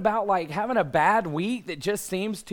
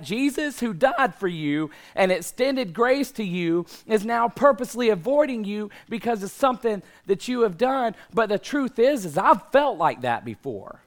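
loud, high, building sermon intensity; preaching; 7 semitones higher, 4 dB louder than usual. Real, not acted. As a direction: preach it with full force. Preaching at full force.

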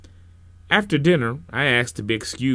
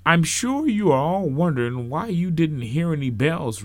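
minister addressing congregation; 6 semitones higher, 3 dB louder than usual. Acted.

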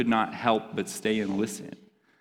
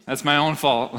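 usual speaking voice

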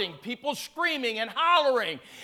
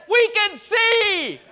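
high, loud, drawn-out cry; jubilant shout; 7 semitones higher, 5 dB louder, much slower than usual. Retold or imitated. Preacher acting out two healed people, shouting. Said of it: "they opened their eyes and they said".

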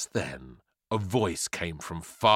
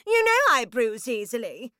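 high-pitched delicate fusspot; complaining pear; 4 dB louder than usual. Acted. Pear reminds the grapefruit it bruises, complaining. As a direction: squeak the complaint in a put-on voice.

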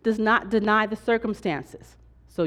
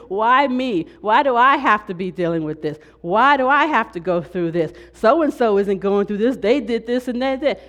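mock complaining; 2 semitones higher, 5 dB louder than usual. Pastor voicing the congregation's grumbling, louder mock whine. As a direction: whine it like you're fed up with life. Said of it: "We all".

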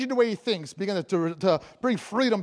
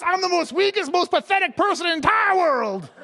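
high, loud exaggerated wail; mock self-pitying outcry; 9 semitones higher, 5 dB louder than usual. Preacher paraphrases Gideon's pity party, loud mock despair. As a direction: proclaim it with comic despair. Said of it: "So what he's saying is".

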